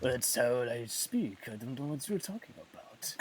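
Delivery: mock Spanish actor, taking his time; Antonio Banderas impression. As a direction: defend yourself as a Spanish film star. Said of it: "that Antonio Banderas impression".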